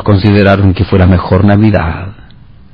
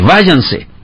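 mechanical; 10 semitones higher than usual. A narrator's reading. Wally repeating the line mechanically.

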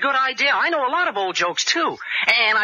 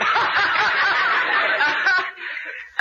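laughter; 9 semitones higher than usual. Real, not acted. Audience laughter after joke.

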